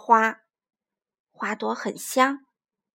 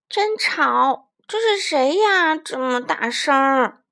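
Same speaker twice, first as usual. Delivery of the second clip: louder, slow annoyed voice; grumpy woken frog; 6 dB louder, slower than usual. Acted. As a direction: complain at being woken.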